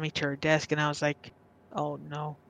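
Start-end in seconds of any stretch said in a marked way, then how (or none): 1.7-2.4 softly retold inner dread; hushed, sinking realization; quiet dread. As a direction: say it small and low-volume, as a private thought. Inward dread retold from childhood.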